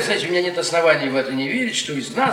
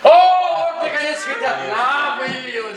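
mock greeting; 9 semitones higher, slower than usual. Sarcastic greeting of entering guest.

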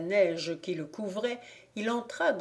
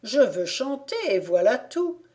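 higher put-on voice; disguised voice; 5 semitones higher, 3 dB louder than usual. A narrator's reading. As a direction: insist in a faked voice. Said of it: "en contrefaisant sa voix".

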